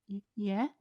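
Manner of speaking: small, stammering yes; frightened. Acted. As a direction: shrink back nervously. Mother frightened.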